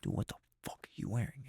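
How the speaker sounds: fully whispered, very soft. Retold or imitated. Brother sneaks up at the interview, whispering in shock. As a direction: hiss it under your breath so nobody hears. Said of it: "whis-, he was like".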